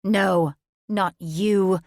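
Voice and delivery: a witch sister slowly forbidding it; hissing sister witch. A narrator's reading. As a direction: draw out each word with force. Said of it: "Her sisters hissed like cornered snakes".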